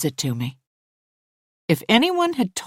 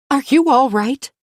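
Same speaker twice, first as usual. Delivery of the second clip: alarmed; 8 semitones higher, 6 dB louder than usual. Acted. Both ask at once, alarmed.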